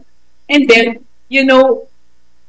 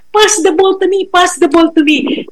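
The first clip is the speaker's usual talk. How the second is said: retold child's shout; 7 semitones higher than usual. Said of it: "nagsisisigaw siya".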